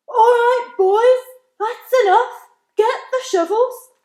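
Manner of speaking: high, loud boss call across the clouds; cloud man shouting. Acted. Cloud man calling his crew, high loud shout. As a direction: bellow orders to the crew. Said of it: "they heard him shouting".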